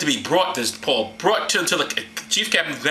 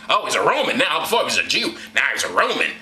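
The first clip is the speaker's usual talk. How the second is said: ordinary delivery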